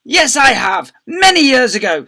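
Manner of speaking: high, loud defiant shout. A narrator's reading. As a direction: shout it back proudly. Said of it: "shouted Miss Seeth".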